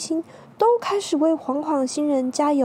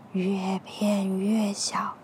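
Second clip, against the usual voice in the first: slow fading whisper; 3 semitones lower, 6 dB quieter, much slower than usual. A narrator's reading. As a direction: fade to a whisper. Hero shrinking away.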